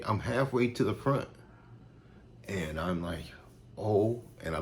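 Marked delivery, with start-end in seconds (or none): 3.8-4.2 sudden high startled oh; a high, louder oh of surprise. Retold or imitated. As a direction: react like you just noticed where you are. Realizing he's halfway down.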